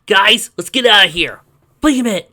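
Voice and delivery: loud, high shout to flee; urgent call. A narrator's reading. Adventurer shouting to party to escape, loud and urgent. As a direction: yell it at your friends to run.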